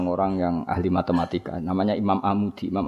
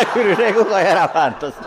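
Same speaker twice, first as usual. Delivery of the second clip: much higher and louder, laughing it out; laughing hard; 9 semitones higher, 7 dB louder than usual. Real, not acted. Preacher jokes the teacher doesn't deserve hell, high and loud laughing. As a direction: squeal it through laughter.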